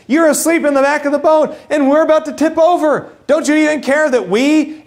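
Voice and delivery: high, urgent accusing plea; panicked plea. Acted. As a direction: wake him, frightened and indignant. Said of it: "I might ask the same question".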